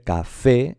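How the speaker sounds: "café" drawn out with the force on FÉ; stress on last syllable. Shown as a demonstration. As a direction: say "café" slowly as ca-FÉ. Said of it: "es porque lleva la fuerza". ("café" alargado, con la fuerza en la última: ca-FÉ)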